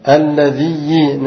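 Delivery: الذي slowly drawn out as alladhiyyi; doubled ya with kasra. Shown as a demonstration. Teacher repeating the fourth form for students, الذي ending in -iyyi. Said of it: "نشد وماذا؟".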